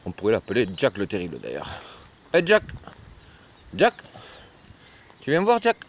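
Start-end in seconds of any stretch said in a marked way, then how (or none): 2.3-5.8 high, loud call to the pig. Real, not acted. Farmer calling Jack the pig over, high and loud. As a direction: call him over from across the yard.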